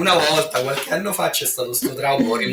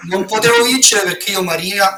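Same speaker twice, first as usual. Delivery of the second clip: raised, insistent protest; loud and indignant; 7 semitones higher, 7 dB louder than usual. Real, not acted. Protests why he could not win.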